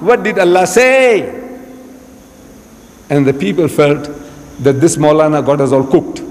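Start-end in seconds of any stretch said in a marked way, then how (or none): 0.1-1.3 higher, drawn-out goading; impatient demand. Retold or imitated. Reverend banging his feet at the Maulana, demanding. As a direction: push slowly and insistently for an answer. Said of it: "again started ba-, banging his feet".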